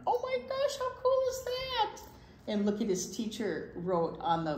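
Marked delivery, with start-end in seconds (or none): 0.1-2.0 put-on high, gushing kid excitement; squealing with delight; excited. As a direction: gasp it out like a thrilled child. Reading an excited child's line.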